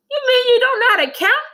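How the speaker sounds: put-on high, fast, loud taunting kid; mocking child. Classmate mocks Joe, high and fast. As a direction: tease like a smug classmate.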